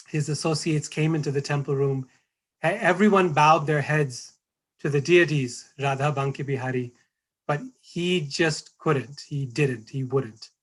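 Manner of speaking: ordinary talk, nothing marked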